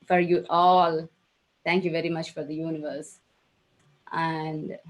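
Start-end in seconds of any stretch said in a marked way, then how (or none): 4.1-4.8 soft, halting, real emotion; really emotional, voice dropping softer; overcome with emotion. Real, not acted. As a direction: apologize softly while choked by feeling, with long pauses. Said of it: "I'm being emotional".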